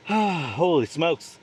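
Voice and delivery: slow, sighing and winded; out of breath. Real, not acted. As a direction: sigh it out, exhausted. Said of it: "I'm out of breath".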